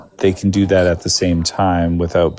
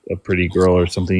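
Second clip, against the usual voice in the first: usual speaking voice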